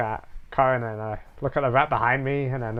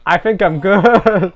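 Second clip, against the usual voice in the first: laughing; 6 semitones higher, 9 dB louder, slower than usual. Real, not acted. Backing off after warning.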